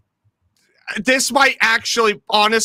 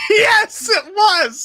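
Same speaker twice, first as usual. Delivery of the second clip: high-pitched excited shout; excited confirmation; 10 semitones higher, much slower than usual. Real, not acted. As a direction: cry out in agreement, voice shooting up. Delighted confirmation after laughing.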